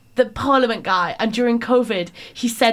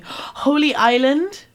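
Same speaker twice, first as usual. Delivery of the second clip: excited announcement; 6 semitones higher, 4 dB louder, slower than usual. Acted. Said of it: "announcing next hotspot".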